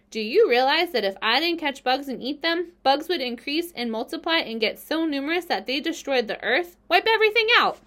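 rising to a high, loud climax; emphatic warning that swells to alarm; building indignation. A narrator's reading. Spider arguing, building to alarming climax.

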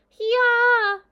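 high, loud braying animal cry; bleating chorus. Acted. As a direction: bray it all together like a flock. Lambs answer all together.

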